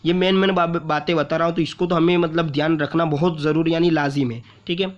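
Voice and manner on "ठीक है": normal talking voice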